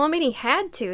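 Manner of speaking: usual speaking voice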